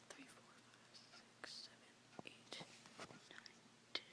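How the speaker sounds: counting softly under her breath, barely voiced; hushed tally of the hours; whispered counting. Real, not acted. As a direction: breathe the numbers out as a near-silent whisper.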